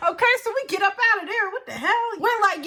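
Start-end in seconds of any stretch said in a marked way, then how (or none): 0.1-1.5 fast, laughing retelling. Real, not acted. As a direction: still giggling as she goes on.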